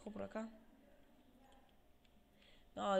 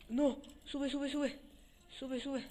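urgent cheering; 6 semitones higher, 6 dB louder, slower than usual. Real, not acted. Urging mob upward during play.

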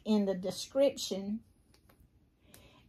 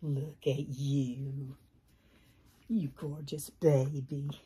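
tender cooing; 6 semitones lower, slower than usual. Acted. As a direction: coo lovingly at the baby. Cooing at doll.

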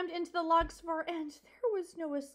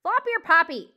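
very loud impatient bellow; 3 semitones higher, 13 dB louder than usual. Acted. Porcupine ordering the mouse aside, bellowing. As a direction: bellow the garbled name. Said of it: "Aerith bellowed".

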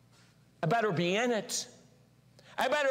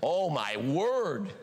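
dismayed groan; 6 semitones lower, much slower than usual. Retold or imitated. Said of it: "then they played it back".

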